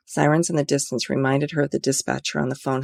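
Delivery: usual speaking voice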